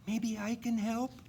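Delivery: high, eager little reindeer voice; young reindeer Dasher. A narrator's reading. Dasher offering to help Santa, high and eager. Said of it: "she said".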